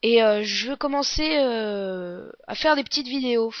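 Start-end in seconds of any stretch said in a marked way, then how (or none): none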